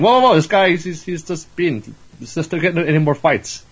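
high, placating protest, loud at first; heading off a fight. Retold or imitated. Acted attempt to stop a fight.

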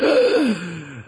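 sharp loud shocked gasp. Retold or imitated. Brothers finding the photo, with a loud shocked gasp.

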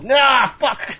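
very high mock cry of defeat; frustrated wail. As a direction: wail the curse in comic frustration. Acted villain's frustration.

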